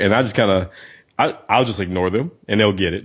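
usual speaking voice

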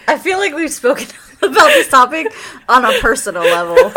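cracking up. Real, not acted. Teasing co-host about a familiar topic, laughing. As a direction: laugh your way through the line. Cracking up at friend's formal disclaimer.

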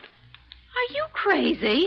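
slow, high, stretched disbelief; can't believe what she's hearing; incredulous. Acted. Startled by odd request.

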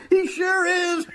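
very high, laughing squeal of glee; high-pitched gloating; gleeful crowing. Acted. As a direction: crow it through your laughter. Crows, helpless with glee.

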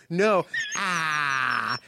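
imitated teacher's "ah", no R; "ah" drawn out, R dropped. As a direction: correct them with a long "ah". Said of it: "she couldn't say R".

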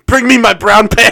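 loud, high comic order; hammed-up panicked command; mock sea captain. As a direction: shout for the crew to fetch it. Acted panicked captain ordering crew.

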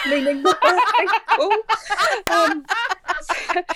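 cracking up mid-sentence; laughing. Real, not acted. Laughing at her own story.

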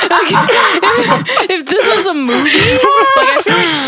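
laughing through the words; can't stop laughing at the idea. Real, not acted. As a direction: giggle while talking. Laughing at the doctor.

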